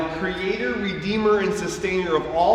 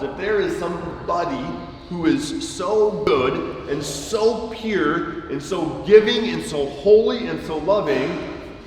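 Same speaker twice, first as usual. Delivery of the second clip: ordinary talk, nothing marked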